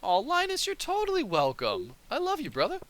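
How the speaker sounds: high sweet voice. Pretend. Gushing at villager Linus.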